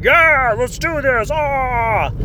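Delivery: hyped squad mates. Retold or imitated. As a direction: pump up the team. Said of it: "And then the other guys".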